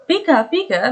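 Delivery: high blurted Pikachu cry; mild imitation of the Pikachu tic. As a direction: let the Pikachu tic burst out. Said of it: "like he was coming out".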